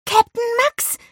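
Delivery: very high, loud excited blurt; bursting out. A narrator's reading. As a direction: blurt it out in sudden realization. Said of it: "sprudelte es aus Olivia heraus". (aufgeregt herausgesprudelt)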